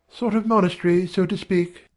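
high-pitched female character; high voice, passing comment; woman's comment. A narrator's reading. Janet comments drily on the vow.

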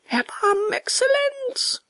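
very high, drawn-out begging; pleading. A narrator's reading. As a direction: implore in a squeaky-high puppet voice. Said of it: "weinte und flehte". (sehr hohes Flehen)

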